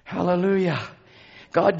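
ordinary talk

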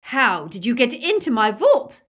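loud, high, furious interrogation; enraged scream. A narrator's reading. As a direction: scream the accusation. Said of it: "they heard Bellatrix scream".

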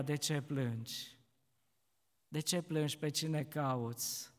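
soft, quiet asking; gentle Jesus. Acted. Jesus gently asks weeping Mary, soft and quiet. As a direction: ask tenderly, almost hushed.

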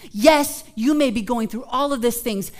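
ordinary talk, nothing marked

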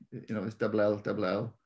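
normal talking voice

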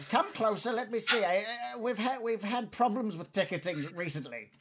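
high goblin voice; officious goblin. Acted. Goblin ticket checker to tour guide, high officious voice.

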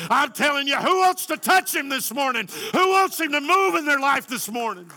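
high, loud climactic preaching; preaching at full force. Acted.

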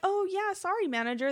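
high, loud fake sorry; mock apology. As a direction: put on an innocent apology for the manager. Acted complaint reply to a manager.

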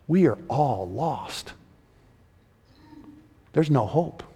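slow, hushed hopelessness; grave, softened preacher's voice; solemn despair. Acted. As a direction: let it sink in softly.

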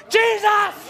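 high, sudden outburst shout. Acted. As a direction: burst out shouting despite the hushing. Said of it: "You're shouting too much".

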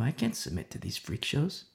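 Chloe's quiet protest. Retold or imitated. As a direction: say it softly as a determined refusal. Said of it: "it was Chloe who knew".

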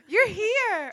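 thrilled the post finally came; excited welcome. Acted. Owl post arrives, role-play.

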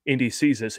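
usual speaking voice

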